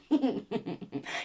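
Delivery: short high giggle; a quick real giggle; light laugh. Real, not acted. Laughs after her little song.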